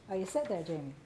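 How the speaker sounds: ordinary talk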